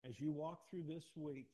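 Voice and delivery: slow it right down, loud, every word weighed; slow, stressed repeat. Shown as a demonstration. Pastor repeats the charge to the congregation slowly and loudly. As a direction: lean into each word of as you walk through this week. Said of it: "repeated for emphasis".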